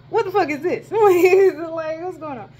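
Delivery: high incredulous squeal breaking into laughter; laughing disbelief. Retold or imitated. Student retelling the bus trouble, high laughing disbelief.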